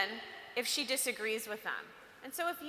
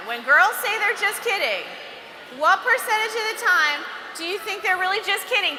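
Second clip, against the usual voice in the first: louder repeat; 6 semitones higher, 8 dB louder than usual. Shown as a demonstration. Speaker repeats her question to the girls, louder and higher. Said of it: "I'll repeat that question".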